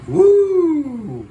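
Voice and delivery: high, loud happy whoop; excited whoop. Real, not acted. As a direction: cheer as the jet flies by. Cheering the stunt jet pass.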